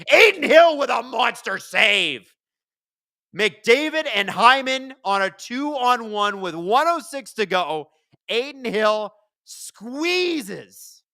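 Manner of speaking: high, loud, drawn-out hockey call, slowing on the big save; excited play-by-play. Acted. Calls the hockey play live.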